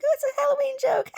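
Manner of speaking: very high, loud, rushed squeal; mocking imitation. Imitating the mother answering her son, shrill mockery. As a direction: mimic her squealing it off as a joke. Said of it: "And she's like".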